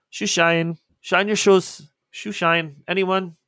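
put-on high, loud shoeshine boy's cry; calling out. Shoeshine boy hawking over crane and truck noise, calling out. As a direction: hawk it across the noisy docks. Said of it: "you do hear a voice kinda come across that".

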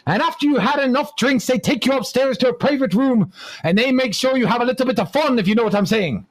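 high, excited mock-Irish boast; joke Irishman. Comic as the Irishman piling on the boasts, high mock-Irish voice. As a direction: build the brag as a hammed-up Irishman. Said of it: "And the Irishman says".

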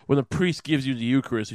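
ordinary talk

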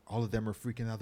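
ordinary talk

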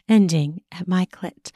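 ordinary delivery, nothing marked

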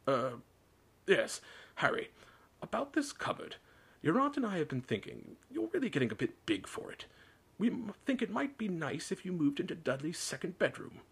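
high, halting false friendliness; awkward, hesitant niceness; forced smile. A narrator's reading. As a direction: coax through a painful smile. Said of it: "forced his face into a smile".

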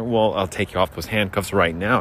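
ordinary delivery

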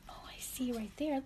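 soft storyteller whisper. Acted. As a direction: hush it to a whisper. Hushed aside while reading.

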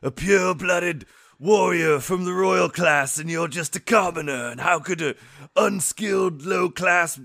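loud, high-pitched haughty villain rant; Vegeta. Acted. Mocking the boss's speech about losing to a commoner, haughty anime-villain outrage. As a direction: rage as an outraged royal warrior. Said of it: "I like your Vegeta voice".

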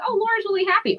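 very high, chirpy reaction; mock happy. Comic looking back on her old material, high and happy. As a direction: pitch it way up, lightly delighted.

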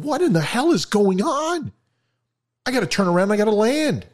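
high-pitched, exasperated outburst; alarmed captain. Acted. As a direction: exclaim like a rattled pilot.

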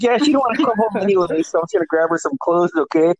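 a brisk busy mother; mom's voice. Retold or imitated.

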